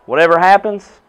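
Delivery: ordinary delivery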